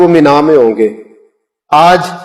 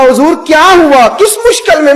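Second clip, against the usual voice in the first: surprised asking; 10 semitones higher than usual. Retold or imitated. हैरान होकर पूछता है.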